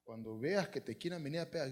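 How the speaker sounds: low, soft motherly advice; hushed voice of a mother instructing; mother confiding. Acted. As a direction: quietly coach your little son. Voices mother advising her little son.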